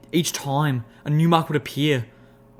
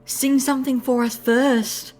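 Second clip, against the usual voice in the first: high, slow squeaky teasing; 11 semitones higher, slower than usual. Acted. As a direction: tease in a tiny helium voice. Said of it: "her own voice even higher".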